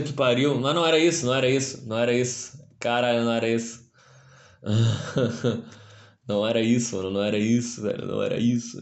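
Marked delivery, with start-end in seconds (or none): 4.6-5.6 low chuckle; laughing. Real, not acted. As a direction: laugh at yourself. Laughing at own mistake. (risada baixa)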